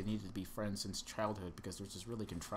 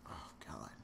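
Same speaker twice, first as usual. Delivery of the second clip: breathy whispered oh god, much softer and lower; 7 semitones lower, 11 dB quieter than usual. Pretend. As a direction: let it slip out in a hushed whisper. Aroused confession, whispered.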